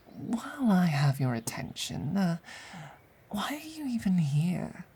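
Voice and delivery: soft, needling whisper; hushed sneer. Acted. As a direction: needle them quietly.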